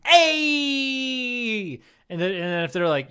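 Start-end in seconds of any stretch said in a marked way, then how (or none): 0.1-1.8 long, high "hey" cheer; drawn-out celebratory call; cheer of approval. Shown as a demonstration. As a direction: holler approval and hold it out. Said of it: "Be like".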